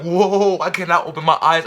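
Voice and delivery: high, loud frightened plea; loud scared wail; scared cry. Retold or imitated. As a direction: shout it in a panic. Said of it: "I was scared, I was freaking out".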